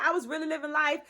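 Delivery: usual speaking voice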